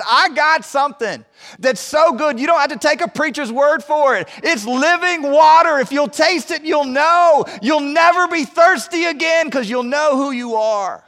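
high, loud, ringing preacher's call; preaching at full force. Acted. Preacher voicing Jesus to the congregation, proclaiming loudly. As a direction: lift it up and proclaim it to the whole room.

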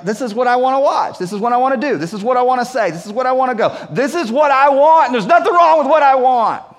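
mounting self-centred demands, ending loud and high; acted selfish inner voice, escalating; selfish insistence. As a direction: build from wanting to loudly insisting. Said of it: "something in you selfishly, like".

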